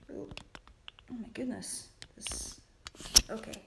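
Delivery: whispered oh my goodness under her breath; whispered aside. Real, not acted. Fumbling the camera, a whisper to herself. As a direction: breathe it to yourself.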